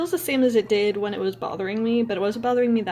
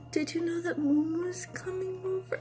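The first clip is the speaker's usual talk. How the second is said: drugged loopy voice; 8 semitones higher, 8 dB quieter than usual. Retold or imitated. Retelling loopy girlfriend's question.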